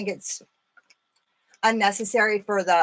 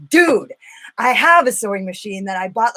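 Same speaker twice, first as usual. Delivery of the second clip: emphatic retold reaction; 7 dB louder than usual. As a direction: imitate a friend's loud, eager reply. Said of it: "she was like".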